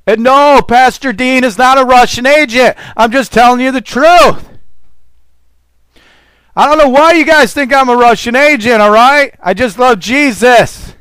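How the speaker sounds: high, loud protesting pulpit voice; heated preaching. Acted. Preacher denies to listeners he is a Russian agent, heated and loud. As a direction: fire back at the doubters from the pulpit.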